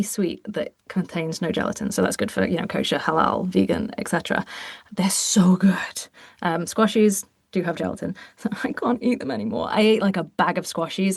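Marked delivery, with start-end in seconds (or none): 4.9-6.1 whispered rave. Real, not acted. Raving about Percy Pigs.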